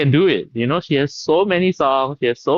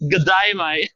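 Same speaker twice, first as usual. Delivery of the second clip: higher put-on Aussie twang; Australian accent; 4 semitones higher, slower than usual. Shown as a demonstration. Guest repeats the greeting on request, Australian accent. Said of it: "That's not bad".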